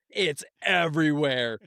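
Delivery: aghast, stretching the words out; retold horrified dismay. Friend finding dog mess downstairs, drawn-out mock horror.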